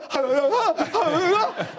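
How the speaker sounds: breathless exhausted wheeze; an exhausted runner's gasping laugh. Retold or imitated. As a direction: pant like you're dying on the track. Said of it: "And I'm literally going".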